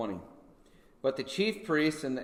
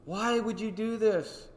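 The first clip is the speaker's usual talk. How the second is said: mock pleading Pilate; 9 semitones higher than usual.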